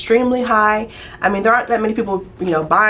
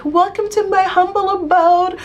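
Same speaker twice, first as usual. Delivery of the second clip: high, showy hostess voice, far from her own; grand pretend welcome; 10 semitones higher than usual. Pretending to welcome guests into her dream house, high and showy. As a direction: play the proud new homeowner greeting guests. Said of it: "open the door and be like".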